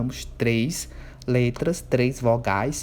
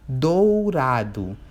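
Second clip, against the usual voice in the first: Dourado drawn out syllable by syllable; slow example word; 3 dB louder, much slower than usual. Shown as a demonstration. Teacher gives pupils the example, slow and deliberate. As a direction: say Dourado very slowly as a model. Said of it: "Vou dar um exemplo".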